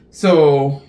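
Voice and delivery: ordinary delivery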